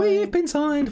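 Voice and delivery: excited. Real, not acted. Repeats the announcement, excited.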